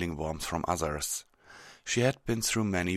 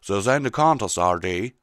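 folksy objection; 3 semitones higher, 9 dB louder than usual. Acted. Applejack rebuts Rainbow's boast.

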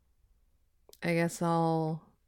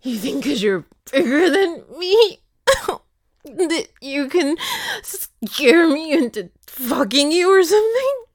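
scornful laughing; 6 semitones higher, 8 dB louder than usual. Acted.

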